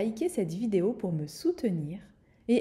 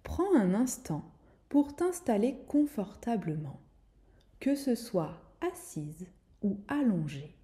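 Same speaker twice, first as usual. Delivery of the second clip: guided meditation; 3 dB quieter than usual. Acted. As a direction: guide her gently into calm. Leads a guided relaxation.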